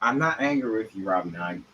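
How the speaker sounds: quiet, falling I'm not angry; murmured reassurance to Robbie; soft denial. Acted. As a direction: softly insist you're not angry. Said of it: "What do you mean I sound angry?".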